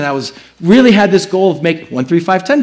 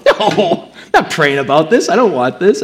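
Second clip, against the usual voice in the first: chuckling no, half-laughed; laughing refusal. Retold or imitated. Husband answering his wife in pillow talk, laughing.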